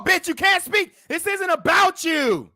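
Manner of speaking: high, loud furious yell at the screen; angry shout. Real, not acted. Streamer yelling at the man in the clip, furious shout. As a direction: shout her down, outraged.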